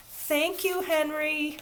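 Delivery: put-on high child's voice; calling after. A narrator's reading. Little girl calling thanks after the traffic boy. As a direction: call after him gratefully. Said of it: "Ramona called after him".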